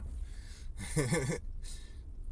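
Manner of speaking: airy rising laugh; breathy laugh. Real, not acted. Driver laughing about his Lexus, breathy laugh. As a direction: let out a breathy chuckle.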